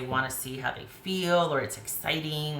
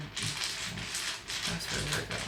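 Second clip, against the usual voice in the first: whispered; 8 dB quieter, much slower than usual. Real, not acted. Whispering while drawing a card.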